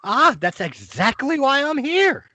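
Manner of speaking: put-on an old wizard greeting the party at the door; Corbin, eager wizard. Corbin explains his visit.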